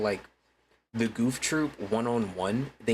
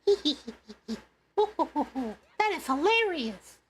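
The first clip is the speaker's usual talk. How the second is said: much higher, forced fake laughter; over-the-top talk-show host cackle; Jimmy Fallon impression; faster than usual. Streamer mocking a host's forced laugh, much higher. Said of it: "That is my Jimmy Fallon, uh, impression".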